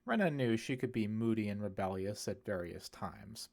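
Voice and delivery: ordinary delivery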